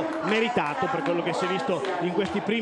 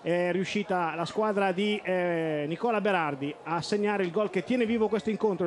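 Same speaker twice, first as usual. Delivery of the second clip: normal talking voice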